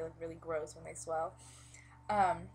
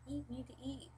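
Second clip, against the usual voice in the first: mimicking nagging; 7 semitones higher, 5 dB quieter than usual. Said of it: "everyone's like".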